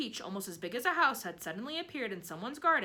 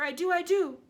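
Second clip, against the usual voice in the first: excited; 10 semitones higher, 10 dB louder than usual. Acted. Aunt excited agreement.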